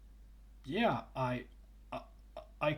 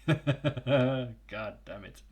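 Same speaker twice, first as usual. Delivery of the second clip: laughing; 4 dB louder, much slower than usual. Real, not acted. Laughing at own stutter.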